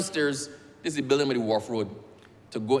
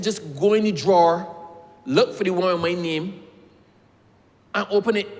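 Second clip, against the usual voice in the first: higher and louder, impatient order; loud, raised, exasperated command; hot-headed demand; 5 semitones higher, 5 dB louder than usual. Retold or imitated. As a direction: bark it at the clerk. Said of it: "my head used to be hot".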